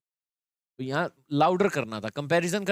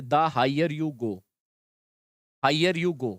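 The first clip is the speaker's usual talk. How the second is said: slow demo; much slower than usual. Shown as a demonstration. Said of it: "आपको मैं the से एक structure बनाना सिखाता हूँ".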